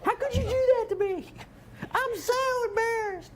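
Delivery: very high-pitched hammed-up distressed wail; Lacey. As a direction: squeal it in a high mock-horrified falsetto. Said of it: "Is that your impression of me?".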